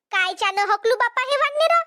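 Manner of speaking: ordinary delivery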